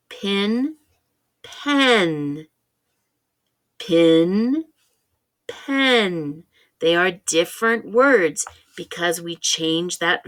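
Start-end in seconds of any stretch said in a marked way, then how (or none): 0.1-6.4 Pin with short i, pen with short e, clearly apart; vowel contrast. Shown as a demonstration. Teacher contrasting the vowels in Pin and pen for kids. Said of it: "Do you hear the difference?".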